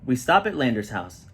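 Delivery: ordinary delivery, nothing marked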